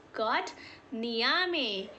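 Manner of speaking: ordinary delivery, nothing marked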